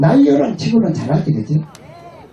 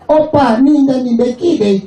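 higher, brisk Gyeongsang woman; 5 semitones higher, slower than usual. Retold or imitated. Gyeongsang woman to her oppa after sex, Gyeongsang accent. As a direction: blurt it like a brash Gyeongsang girl. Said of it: "경상도 얼마나 화끈해".

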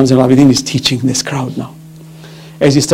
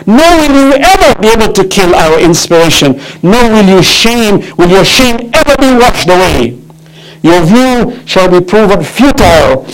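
impassioned, stretched-out proclamation; defiant preaching; 6 semitones higher, 4 dB louder than usual. Acted. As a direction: declaim it defiantly from the pulpit. Preaches Zainab's defiant speech.

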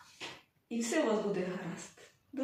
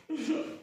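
a brief, light laugh; short chuckle; 4 semitones higher than usual. Real, not acted. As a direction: chuckle briefly after the tale. Narrator chuckles after the tale. (короткий смішок)

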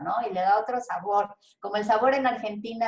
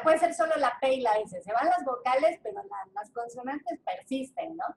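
ordinary delivery

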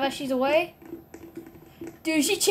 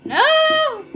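panicked shout; 12 semitones higher, 6 dB louder than usual. Real, not acted. Chased in game, cries out.

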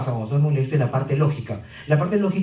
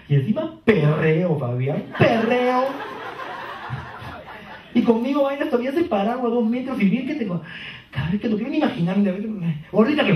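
put-on angry girlfriend; 6 semitones higher than usual. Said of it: "Entra ella así".

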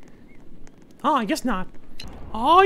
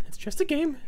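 whispering; 8 dB quieter than usual. Real, not acted. Whispered to himself.

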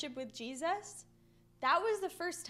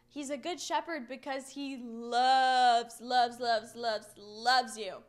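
slow, lower repeated loves, loves, loves; slowing repetition; 3 semitones lower, slower than usual. Acted. Preacher telling children how much Jesus loves them, slowing down. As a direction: let each "loves" land slower and lower.

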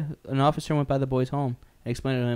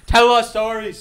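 whiny shout; 10 semitones higher, 10 dB louder, slower than usual. Pretend. Whining demand repeated.

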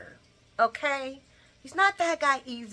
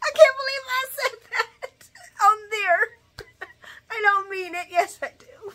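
laughing; 12 semitones higher, 4 dB louder than usual. Real, not acted. Laughing at own remark.

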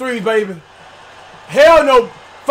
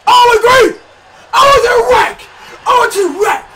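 much higher and louder hype yell; ecstatic shout; 9 semitones higher, 5 dB louder than usual. Real, not acted. Gamer yelling over his player's big play, ecstatic shout.

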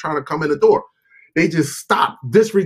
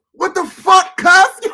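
amazed outburst; 12 semitones higher, 4 dB louder, much slower than usual. Real, not acted. Marvelling at alien science.

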